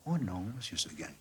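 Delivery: quiet, meek reply; soft little goat. A narrator's reading. Blanquette answering her master, soft and meek. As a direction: answer gently, head down.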